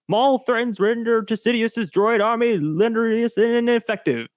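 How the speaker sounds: high, announcer-style recap read; dramatic recap narration. Acted. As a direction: read it like a trailer voiceover. Reads episode recap.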